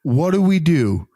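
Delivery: high-pitched, insistent reassurance; mock coaching. Acts out coaching a flustered junior Marine.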